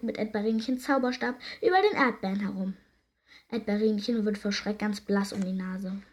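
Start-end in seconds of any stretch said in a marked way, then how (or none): none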